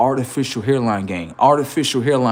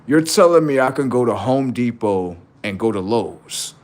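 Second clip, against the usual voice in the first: ordinary talk, nothing marked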